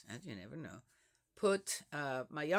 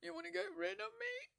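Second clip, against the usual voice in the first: high, fast mock-wounded squeak; a playful fake-offended mother; fake hurt; 8 dB quieter, faster than usual. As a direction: pretend to be hurt and small. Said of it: "kind of fake there going".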